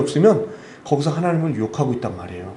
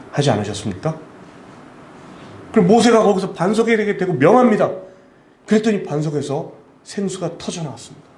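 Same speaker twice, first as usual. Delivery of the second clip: normal talking voice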